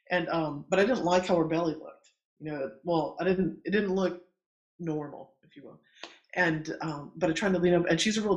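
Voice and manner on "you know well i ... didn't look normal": normal talking voice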